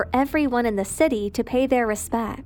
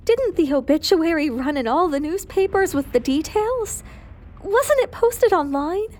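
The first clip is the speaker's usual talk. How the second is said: put-on higher, bewildered young widow's voice; Gina's upset voice; 5 semitones higher than usual. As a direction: climb higher on the last question, disbelieving. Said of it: "Gina, Keith's wife, asked Daphne".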